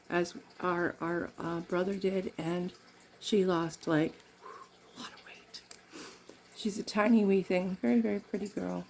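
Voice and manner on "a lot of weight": whispered, almost no voice; barely audible whisper; hushed aside. Real, not acted. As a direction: drop to a whisper as a confidential aside. Hushed aside about sister's weight.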